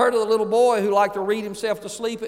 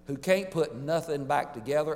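normal talking voice

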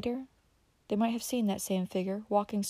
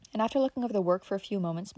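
usual speaking voice

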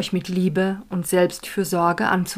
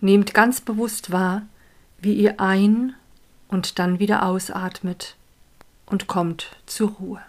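calm, unhurried guiding voice; guided meditation. Acted. Leads a meditation.

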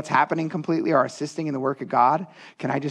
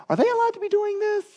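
high-pitched, hammed-up fussy governor voice; Tatanai; 13 semitones higher than usual. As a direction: put on a squeaky doubting official. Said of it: "I don't know if that's how he talked".

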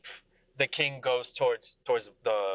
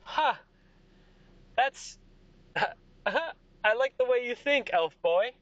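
high, slow, chuckling approval; amused king; 13 semitones higher, 4 dB louder, slower than usual. Acted. King approving of an elf boy, amused.

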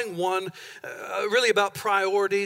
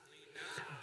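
whispered lean not; 22 dB quieter than usual. Shown as a demonstration. Pastor to congregation, whispering lean not. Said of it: "Everybody say".

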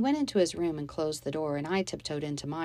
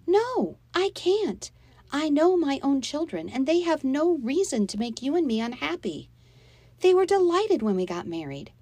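higher, louder mother arguing back; raised voice; 9 semitones higher, 5 dB louder than usual. A narrator's reading. Mother defending her children to her husband, voice raised. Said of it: "Mom's voice rose too".

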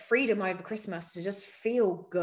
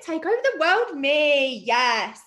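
cheering; 5 semitones higher, 6 dB louder, slower than usual. Real, not acted. Cheering at selfie.

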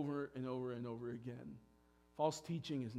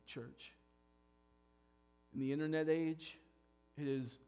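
ordinary talk

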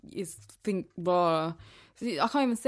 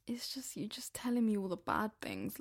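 whisper; 8 dB quieter than usual. Real, not acted. Complaining about bad news.

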